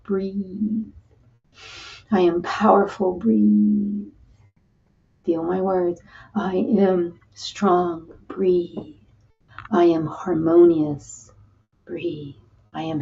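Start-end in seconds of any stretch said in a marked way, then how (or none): none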